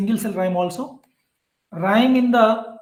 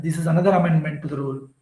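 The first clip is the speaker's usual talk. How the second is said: whispered aside; 3 semitones lower, much faster than usual. Real, not acted. Quick whispered aside to class.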